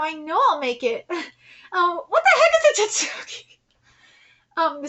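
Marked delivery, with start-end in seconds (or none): 1.7-3.6 high, loud mock confusion; incredulous outburst; baffled exclamation. Real, not acted. Fan mocks confused character.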